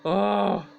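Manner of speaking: loud pained "ah"; frustrated groan. Real, not acted. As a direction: let out an annoyed cry. Frustrated after own crash.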